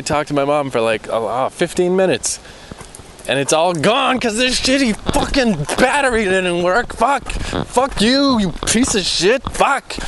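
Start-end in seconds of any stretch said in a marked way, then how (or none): 3.3-10.0 much higher, strained angry cursing; furious swearing. Real, not acted. Cursing the battery that lost his recording, furious. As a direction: curse the dead battery in frustration.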